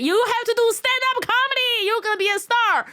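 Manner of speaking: high-pitched, emphatic retold urging; John Singleton. Retelling a director urging her into stand-up, excited.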